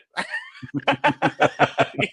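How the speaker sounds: high, long burst of laughter; embarrassed real laugh; big laugh. Real, not acted. Recalling embarrassment.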